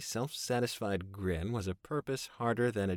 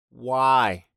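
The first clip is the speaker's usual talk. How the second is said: louder, sharp demand; 4 semitones higher, 13 dB louder than usual. A narrator's reading. Narrator challenges Fraser.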